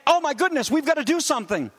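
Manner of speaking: high, fast mock panic; imitating a panicked mother. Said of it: "From a panicked".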